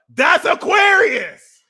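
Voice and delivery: high, loud, slow shout. Real, not acted. Exclaiming about Aquarius, loud. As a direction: belt it out.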